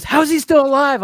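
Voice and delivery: high, loud disbelief; imitating dad shocked at the trailer; baffled dad. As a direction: blurt it out, totally lost. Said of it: "And my dad goes".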